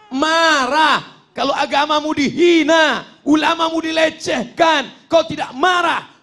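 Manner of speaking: high, loud rising shout; fiery preaching. Acted. Preacher urging the faithful to anger, shouting. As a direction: rouse the crowd to anger.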